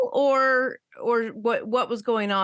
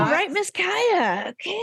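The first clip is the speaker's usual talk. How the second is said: mock offended; 10 semitones higher than usual. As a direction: play affronted at a cheeky child. Mock reply to cheeky child.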